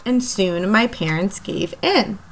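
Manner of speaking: ordinary delivery, nothing marked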